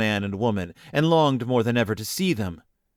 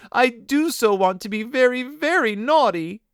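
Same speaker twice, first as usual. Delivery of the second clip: put-on high, wailing child, slowing at end; bitter sobbing; 11 semitones higher than usual. A narrator's reading. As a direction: wail up high, stretch the last words. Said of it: "sobbed more and more bitterly".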